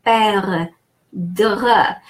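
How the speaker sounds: "Perdre" drawn out, open E as in egg; French pronunciation. Shown as a demonstration. Teacher showing the final E to learners, "Perdre" sounded out in French. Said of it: "So the final E is".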